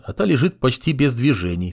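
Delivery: ordinary delivery, nothing marked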